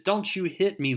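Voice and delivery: high, sharp outburst; angry Phoenix. Acted. Jean Grey furious at Wolverine, high sharp outburst. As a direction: snap back at the man who hit her.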